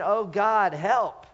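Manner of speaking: pleading out loud, half yelled; yelling a little. Acted. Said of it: "maybe even yelling it a little bit".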